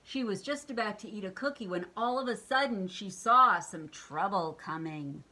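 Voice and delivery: plain reading voice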